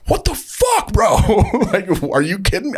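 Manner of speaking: loud, very high re-enacted outburst; furious freak-out. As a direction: yell in outraged disbelief, acting out the moment. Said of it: "I was so mad, freaking out".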